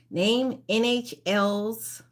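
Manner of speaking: normal talking voice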